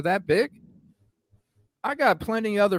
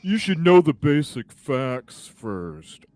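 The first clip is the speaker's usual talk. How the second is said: slow, snooty parody of a critic; mock troll quote; slower than usual. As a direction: drag it out like a smug lecturer. Quoting a troll's comment mockingly.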